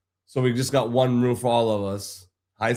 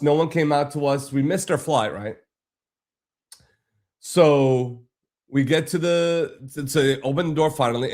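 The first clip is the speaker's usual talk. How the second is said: ordinary talk, nothing marked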